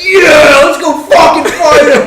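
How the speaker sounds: high, loud pack of hyped-up bros; rowdy yell. Retold or imitated. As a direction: yell it like a crowd spoiling for a fight. Douchebags hyped up to fight.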